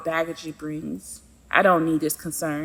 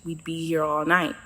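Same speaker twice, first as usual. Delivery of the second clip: ordinary delivery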